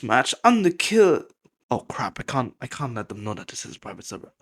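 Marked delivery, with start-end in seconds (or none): 1.7-4.3 quick, hushed aside, much softer. Real, not acted. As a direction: rush it out under your breath. Hurried aside hiding private server.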